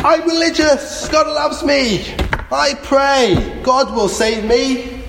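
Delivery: high, sustained call in reply; shouting back. Acted. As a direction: shout your faith up to the helicopter. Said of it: "again, the man shouted back".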